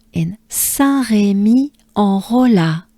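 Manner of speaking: Saint-Rémy-en-Rolla, drawn out slowly; careful teacher pronunciation; slow model. Shown as a demonstration. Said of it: "Let's do it together".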